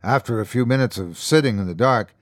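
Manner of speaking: usual speaking voice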